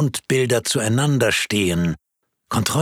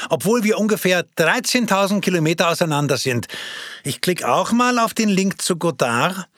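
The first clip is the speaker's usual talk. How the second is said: eager, raised voice of a friend online; excited friend; 10 semitones higher, 3 dB louder than usual. Acted. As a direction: pitch it up and keep the enthusiasm going. Character speaks to friend. (begeisterter Freund, deutlich höher gesprochen)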